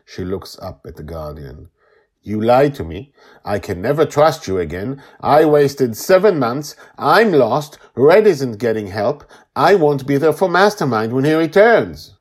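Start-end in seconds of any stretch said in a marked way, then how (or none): 2.2-12.2 loud, raised, mounting outburst; angry accusation. A narrator's reading. Joy tells the Guardian she cannot trust him, angry outburst. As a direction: build from accusation into loud, high anger.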